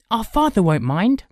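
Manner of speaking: put-on high, loud boyish insistence; insistent boy. A narrator's reading. Boy overruling his brother's warning, insistent. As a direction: insist over the objection, high-pitched. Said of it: "Arthur insisted".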